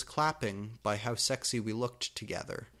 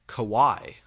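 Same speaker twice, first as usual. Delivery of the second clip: commented happily; 9 dB louder than usual. Acted. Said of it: "I commented happily".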